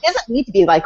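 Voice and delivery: normal talking voice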